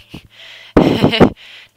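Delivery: high loud burst of laughter; loud unvoiced laugh. Real, not acted. Laughing at horrifying model.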